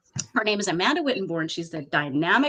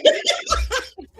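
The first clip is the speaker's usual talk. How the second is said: high laugh; 3 dB louder than usual. Real, not acted. Laughs at her own story.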